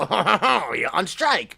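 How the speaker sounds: hammed-up French "ha ha ha"; mock French laugh. Shown as a demonstration. Showing how to do French, mock French laugh. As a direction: laugh it out as a caricature French accent. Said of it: "in France you just go".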